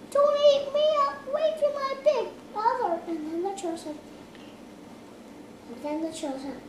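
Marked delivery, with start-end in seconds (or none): 0.1-3.0 fast squeaky goat plea; high pleading goat. Acted. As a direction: beg quickly like a goat.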